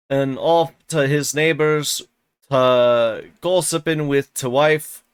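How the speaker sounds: put-on slow, halting broad Yorkshire old servant; slow struggling rustic dialect reading; Joseph's thick dialect. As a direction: read the old servant's dialect, stumbling slowly.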